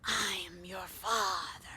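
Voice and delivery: slow, whispered Vader reveal; whispered movie villain reveal; Darth Vader impression. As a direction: breathe it like Darth Vader, slowly. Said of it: "like Darth Vader saying".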